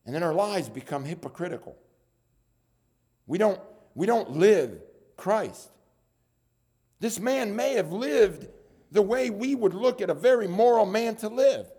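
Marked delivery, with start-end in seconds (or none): 3.3-11.7 forceful preaching. Acted. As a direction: preach it out high and loud.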